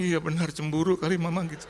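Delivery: high-pitched mock retort; acted inner retort. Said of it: "Dalam hati".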